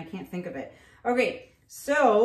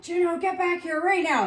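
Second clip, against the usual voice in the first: high, raised call after a dog; mock yell, calling the dog back; retold yell; 9 semitones higher, 5 dB louder than usual. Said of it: "I yelled at him".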